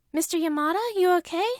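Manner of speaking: high, anxious little voice; worried child. A narrator's reading. Izuku checks on the wheezing babysitter, worried and high. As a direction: ask with a kid's worried concern. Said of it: "Izuku asked worriedly".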